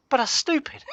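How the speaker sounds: high, incredulous protest; a youngster's outraged complaint; indignant retort. Retold or imitated. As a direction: insist it makes no sense. Retelling arguing with his dad.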